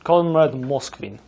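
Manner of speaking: hammed-up Soviet comrade in a Russian accent; lightly put-on Russian accent; mock Russian accent. Mock Russian anchor persona.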